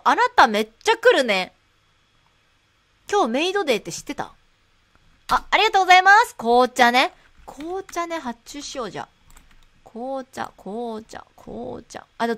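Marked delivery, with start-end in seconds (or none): 5.3-6.4 high, loud, fast maid; chirpy maid thanks; maid cafe voice. Pretend. Maid-themed shop.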